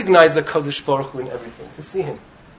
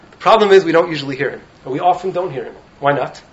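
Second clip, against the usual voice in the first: normal talking voice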